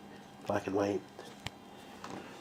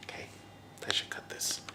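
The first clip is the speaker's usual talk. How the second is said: whispering; 8 dB quieter, faster than usual. Real, not acted. Aside to herself.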